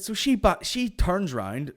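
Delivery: usual speaking voice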